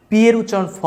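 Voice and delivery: ordinary talk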